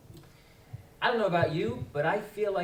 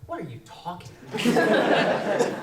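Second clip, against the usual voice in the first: incredulous; 5 semitones higher, 8 dB louder than usual. Acted. Incredulous retort, loud and slow.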